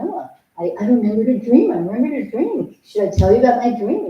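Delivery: bursting to tell her about the dream; eager to share. Retold or imitated. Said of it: "I come in and I say".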